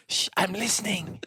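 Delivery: light mock hush, a hint of a put-on voice; shushing ear. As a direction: shush them like a fussy little ear.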